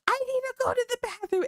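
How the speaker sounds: high, hurried, imitating hysterical laughter; imitating mom laughing. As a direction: squeal it like mom mid-giggle fit. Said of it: "while laughing hysterically".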